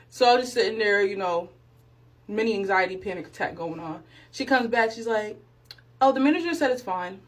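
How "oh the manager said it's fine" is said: ordinary talk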